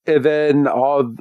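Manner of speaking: ordinary talk, nothing marked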